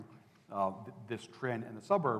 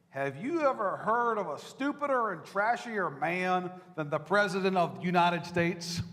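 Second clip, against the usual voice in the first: put-on Texas woman's accent; 7 semitones higher, 7 dB louder than usual. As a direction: lightly imitate a blunt Texas Republican woman. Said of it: "I can't do the accent justice".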